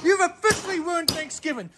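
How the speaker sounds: very high, shouted accusation with ruined hammered; dad shrieking at the kid; furious scream. Acted. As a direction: scream it like everything is over. Repeats the accusation.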